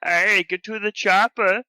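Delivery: Arnold impression. Shown as a demonstration. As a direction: attempt the Austrian action-hero accent again, badly. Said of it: "give it another shot".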